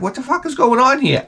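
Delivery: put-on high, outraged kid shock; a kid stunned by mustard on his burger. Kid at McDonald's, high and outraged. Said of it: "I'm like".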